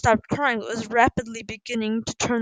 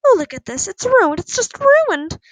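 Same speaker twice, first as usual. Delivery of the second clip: loud, high-pitched wail of dismay; screeching; 10 semitones higher, 8 dB louder than usual. A narrator's reading. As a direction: screech in despair, climbing higher. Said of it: "Missus Pendle screeched".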